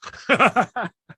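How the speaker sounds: high, loud laugh. Real, not acted. Laughing at himself about annoying people, high loud laugh. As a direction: crack up at your own mischief.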